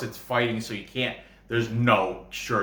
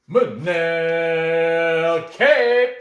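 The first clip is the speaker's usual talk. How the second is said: very slow, loud, higher ring-announcer drawl of the name; Bruce Buffer announcement; 5 semitones higher, 6 dB louder, much slower than usual. Retold or imitated. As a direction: stretch the name out like a fight announcer. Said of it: "hear Bruce Buffer say it".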